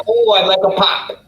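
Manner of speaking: demonstrating a lilting Wisconsin accent. Shown as a demonstration. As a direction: put on a sing-song Wisconsin accent. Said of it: "if you do it with the, with that accent".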